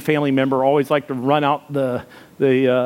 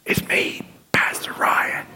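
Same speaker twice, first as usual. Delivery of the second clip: straining, voiceless whisper; lost voice; slower than usual. Acted. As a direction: whisper as if your voice is gone. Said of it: "I can't talk".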